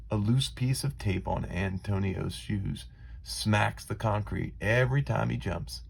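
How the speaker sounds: ordinary delivery, nothing marked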